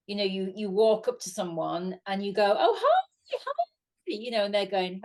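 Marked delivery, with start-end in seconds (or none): 2.6-3.6 very high, gushing hello; imitated keen greeting. Fan greets celebrity mistaken for friend, gushing imitation. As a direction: mimic greeting a long-lost friend, pitched way up.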